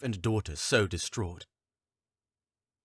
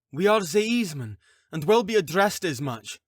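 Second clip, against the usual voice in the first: defiant retort; 10 semitones higher, 6 dB louder than usual. Acted. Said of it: "Faith countered".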